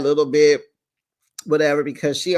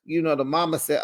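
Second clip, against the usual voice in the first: ordinary talk, nothing marked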